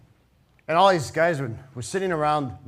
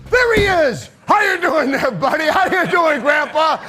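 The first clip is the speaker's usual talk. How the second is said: very high, loud gleeful yell; delighted shout; 10 semitones higher, 8 dB louder than usual. Retold or imitated. Butch greeting his own reflection, shouting with delight. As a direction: shout with joy at the mirror.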